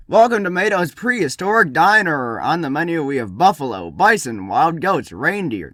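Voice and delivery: loud, high-pitched welcome, then menu patter; showy diner host. Acted. Host welcoming guests to a prehistoric diner, showy announcing. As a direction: present the menu like an eager host.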